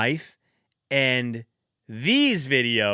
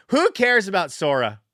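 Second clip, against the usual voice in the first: usual speaking voice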